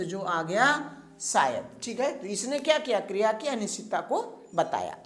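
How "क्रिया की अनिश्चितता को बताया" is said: usual speaking voice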